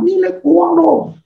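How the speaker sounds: high, drawn-out possessive brag; mock boast. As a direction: stretch it out high, staking a playful claim. Mimics possessive boast he rejects.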